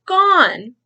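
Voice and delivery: high loud joyful announcement; triumphant gone. A narrator's reading. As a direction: announce it with delight. Cloud has vanished.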